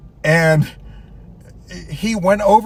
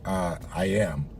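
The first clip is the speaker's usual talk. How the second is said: deadpan reply; 11 semitones lower, 10 dB quieter, slower than usual. Retold or imitated. Customer doesn't believe he's manager.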